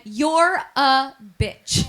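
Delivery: very slow, word by word; deliberate readout. Retold or imitated. Reading grandma's text message, slow and deliberate. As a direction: read it out slowly, each word separate. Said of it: "and it read".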